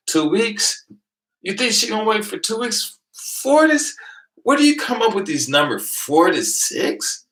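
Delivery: high, loud ridicule; mocking disbelief. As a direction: scoff at their numbers, pitched high. Ridiculing numbers.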